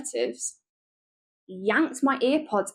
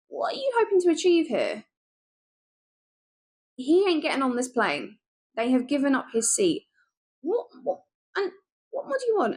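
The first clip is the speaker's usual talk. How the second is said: high-pitched incredulous demands, climbing higher; exasperated retort; 5 semitones higher than usual. Retold or imitated. To the mum kicking off at the air hostess, a high exasperated retort. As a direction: throw it back at her in disbelief.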